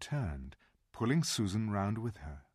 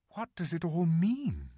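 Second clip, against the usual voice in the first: put-on higher, wondering girl's question; curious girl voice; 8 semitones higher than usual. A narrator's reading. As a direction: ask in wonder. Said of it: "asked Susan".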